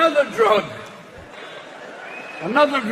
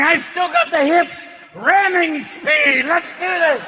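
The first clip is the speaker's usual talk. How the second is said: cocky penis hype; 6 semitones higher than usual. Acted. Penis cheering on the old man, pumped yell. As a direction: hype yourself up like a young stud. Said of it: "your penis is going".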